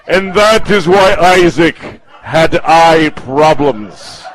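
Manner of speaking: slow, loud storyteller's conclusion, falling on "problems"; mock-solemn proclamation. Comedian mocking an audience member's Bible explanation, mock-solemn. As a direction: stretch out "eye problems" as a grand moral.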